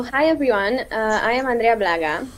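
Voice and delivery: ordinary delivery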